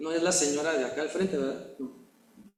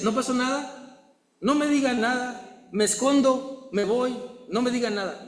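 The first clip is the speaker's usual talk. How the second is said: higher, hurried denial of blame; evasive excuses; 5 semitones higher than usual. Retold or imitated. Person caught in a mistake dodging blame, higher and rushed. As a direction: brush it off and slip away.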